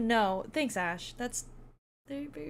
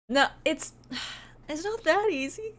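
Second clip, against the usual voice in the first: higher, louder mock protest; 7 semitones higher, 6 dB louder than usual. Real, not acted. Streamer protests to chat that she isn't easy to make cry. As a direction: push back with a laughing protest.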